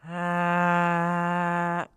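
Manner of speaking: loud, high sustained "ah" drone; held monotone imitation of restaurant din; imitated background noise. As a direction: blast one long steady noise. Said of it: "here's the background noise at Chipotle".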